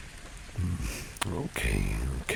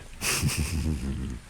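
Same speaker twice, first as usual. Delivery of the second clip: higher-pitched rippling chuckle; amused chuckle; 4 semitones higher than usual. Acted. Boss flirting with employee as bodyguard, amused chuckle. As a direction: chuckle at your own suggestion.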